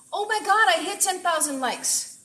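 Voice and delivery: real excitement, high and loud; thrilled surprise. Real, not acted. Surprise at hitting ten thousand likes.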